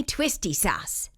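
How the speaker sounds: ordinary delivery, nothing marked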